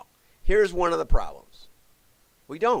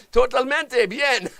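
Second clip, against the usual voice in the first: slow, laughing mock Spanish accent, higher and louder; hammed-up Spanish accent; 9 semitones higher, 6 dB louder, slower than usual. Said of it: "I don't have a clue".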